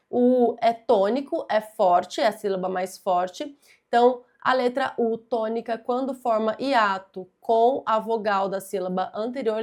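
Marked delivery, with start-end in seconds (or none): none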